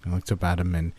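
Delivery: plain reading voice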